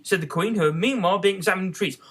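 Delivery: plain reading voice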